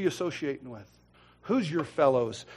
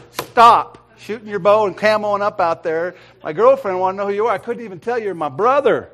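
raised-voice, exasperated telling-off; retold scolding; 5 semitones higher, 10 dB louder than usual. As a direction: scold him, higher and louder. Retold scolding by his brother.

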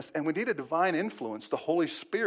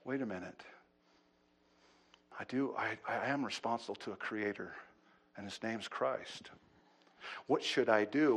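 much softer, lower, a convicted sinner thinking aloud; humbled sinner; 6 semitones lower, 7 dB quieter than usual. Retold or imitated. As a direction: hush down as the truth sinks in.

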